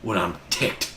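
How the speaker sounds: low, slow mock-angry warning; mock menace. Host warns listeners about his temper, mock menace. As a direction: drag it out like a pretend threat.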